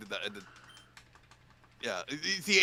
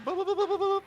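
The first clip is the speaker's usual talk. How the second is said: imitated beeps; faster than usual.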